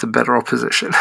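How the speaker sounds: mock-pained repeat; rising, reluctant admission; grudging, pained. Real, not acted. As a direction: force the words out like they hurt. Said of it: "that kills me to say that".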